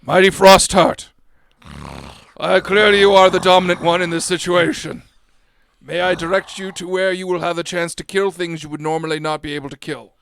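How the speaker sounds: lightly grandiose rider's proclamation; grand address to beast. Acted. Rider addressing a mighty wolf, mildly grand formal appeal. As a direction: open loud, then settle into courtly request.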